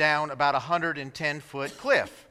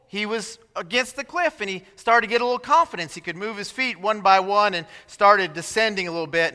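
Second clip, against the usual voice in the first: ordinary delivery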